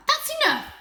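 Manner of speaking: high, loud angry shout; a hurt cat shouting her friend down. A narrator's reading. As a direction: yell it to shut her up. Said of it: "shouted Holly".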